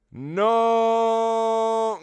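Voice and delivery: high, loud, long-held no; hammed-up wail of grief; crying out. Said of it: "Gabe cried again".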